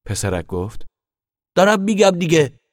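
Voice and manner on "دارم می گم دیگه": put-on high, loud childish protest; impatient little boy. Boy hurried by mother, protests.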